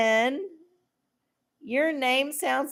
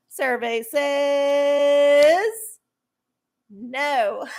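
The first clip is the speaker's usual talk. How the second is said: game show host; 3 semitones higher, 4 dB louder, much slower than usual. Pretend. Game show reveal joke.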